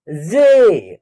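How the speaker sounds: जे with a long, held ay; letter pronunciation. Shown as a demonstration. Teacher showing how J is said, जे drawn out. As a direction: stretch out जे. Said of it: "तो इसका उच्चारण होगा".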